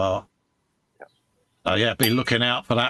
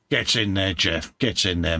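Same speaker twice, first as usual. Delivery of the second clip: ordinary delivery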